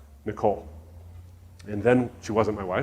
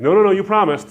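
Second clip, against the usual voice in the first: higher, louder pleading objection; acted-out urgent nagging; insistent protest; 7 semitones higher, 8 dB louder than usual. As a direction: insist they keep their word. Friends insist he keep promise.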